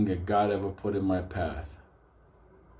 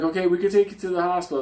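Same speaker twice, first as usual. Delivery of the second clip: paramedic offering; 8 semitones higher, 4 dB louder, much faster than usual. Retold or imitated. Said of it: "He's like".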